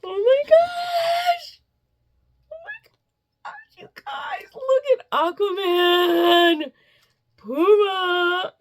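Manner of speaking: very high, slow excited shriek; squealing with delight. Real, not acted. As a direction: shriek with joy at the shirt. Gasping at the Aquaman shirt.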